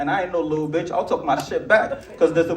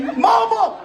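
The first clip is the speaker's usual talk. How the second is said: shout; 4 dB louder than usual. Real, not acted. Shout showing off hair.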